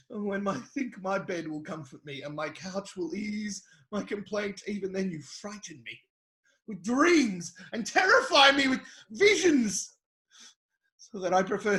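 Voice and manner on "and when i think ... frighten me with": much softer, withdrawn and low; hushed lament. Acted. Job confessing his night terrors to God, in a hushed voice. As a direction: sink into a near-hush of despair.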